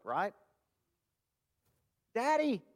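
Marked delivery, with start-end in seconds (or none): 2.1-2.6 put-on "Daddy." said much higher, like a hurt little child; child calling daddy. Shown as a demonstration. Preacher showing the congregation a child calling "Daddy." in a much higher voice. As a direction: call "Daddy." like a child who needs his father. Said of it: "when you need something or when you're hurt".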